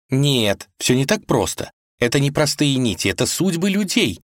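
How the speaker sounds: put-on high, patient girl's voice; young woman voice. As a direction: explain it gently but keenly. Vasilisa voiced in story.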